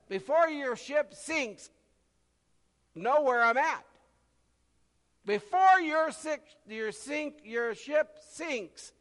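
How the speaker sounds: voicing the Lord's promise from the pulpit; preaching at full force. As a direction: thunder it out to the congregation.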